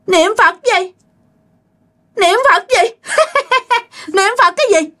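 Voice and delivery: high, sneering spirit voice with a laugh; mocking ghost. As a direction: taunt the prayer like a ghost. Ma chế giễu lời niệm Phật. (giọng ma cao, chế nhạo)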